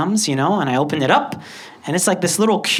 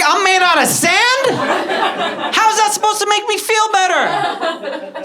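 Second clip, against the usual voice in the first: high, slow, laughing disbelief; can't believe what you just heard; incredulous; 3 dB louder than usual. Retold or imitated. Boy asking his friend how this should make him feel better, high and slow. Upset at being called sand.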